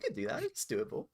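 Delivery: soft, high near-whisper aside; quiet aside. Real, not acted. A quiet aside agreeing with the friends. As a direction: murmur it half under the breath.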